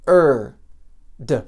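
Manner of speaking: loud held "Er", pause, short "D"; segmented demo of "Er" and "D"; sound by sound. Shown as a demonstration. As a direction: break the word into a long "Er", then a separate "D". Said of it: "Só tem três sons".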